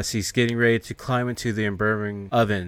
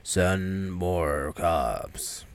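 slow, low, softer zombie moan over a radio; 4 semitones lower, 5 dB quieter, much slower than usual. Acted. Zombie calling dispatch on police radio, slow low moan. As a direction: drag it out like a dead man on the radio.

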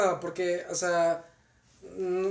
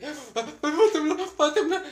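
high, mock-panicked wail, much higher; panicked mother; 10 semitones higher, 6 dB louder than usual. Retelling mom panicking during the quake, high mock panic. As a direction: imitate mom's panic, pitched way up. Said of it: "mi mamá toda paniqueada así".

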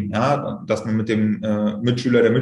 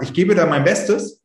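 confident self-talk; 7 semitones higher than usual. Shown as a demonstration. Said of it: "indem man zum Beispiel sagt".

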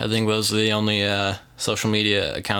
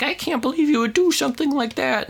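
very high, put-on scolding mom; mom impersonation. Podcast host impersonating a mom scolding her son, high mock-mom voice. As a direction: imitate a mother in a squeaky high voice. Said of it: "that's my impersonation of a mom".

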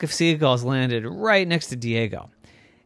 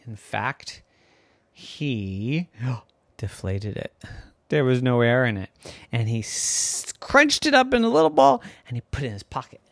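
plain reading voice

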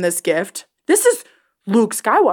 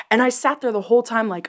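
usual speaking voice

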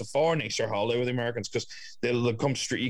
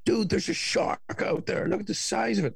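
American impression; 11 semitones higher than usual. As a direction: play the awestruck tourist. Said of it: "I love your American impression".

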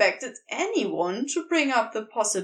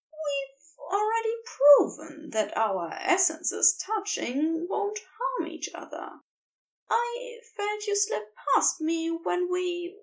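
a little higher, gentle angel voice; lightly voiced angel; 8 semitones higher than usual. A narrator's reading. Angel reminds Crowley of touching, gently higher.

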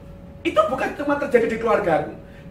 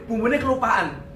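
ordinary talk

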